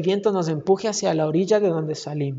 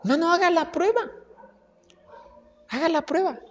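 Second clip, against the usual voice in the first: raised, inviting challenge; a hint of a divine dare, pitched up; voicing the Lord's challenge; 8 semitones higher, 4 dB quieter than usual. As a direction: dare them gently to try it. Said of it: "y después dijo".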